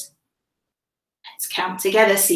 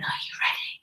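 whispering; 11 dB quieter, much faster than usual. Acted. Whispered before song.

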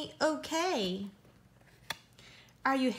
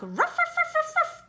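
much higher, louder yapping ruffs; excited dog barks; 4 dB louder than usual. Pretend. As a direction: bark like a thrilled little dog. Excited dog sound in book.

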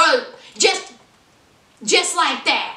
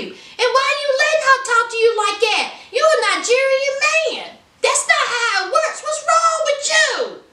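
rising higher line by line into disbelief; incredulous friend; 9 semitones higher than usual. Retold or imitated. Homeboy scolding Soldier for letting her talk, building incredulous. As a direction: push it up, more scandalised each line. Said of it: "his homeboy says".

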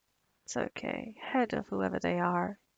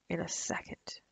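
menacing whisper; 2 semitones lower, 7 dB quieter than usual. Acted. Vampire warning the guest about her mate, menacing whisper. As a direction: drop to a soft whisper on the threat.

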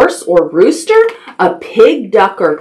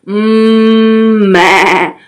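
drawn-out hum into a wavering bleat; goat bleat; 2 semitones lower, much slower than usual. Shown as a demonstration. Storyteller shows children what a goat says, bleating. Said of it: "A goat says".

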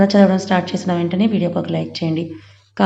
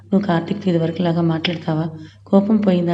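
usual speaking voice

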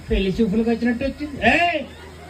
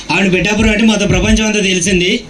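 ordinary talk, nothing marked

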